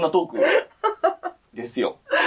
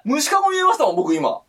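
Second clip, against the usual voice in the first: usual speaking voice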